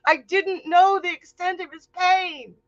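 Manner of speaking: high, loud crying plea; tearful pleading. Acted. Hera begging Zeus to spare Ganymede, crying in a high loud voice. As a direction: beg him through tears, voice breaking high. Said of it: "tears running down her face".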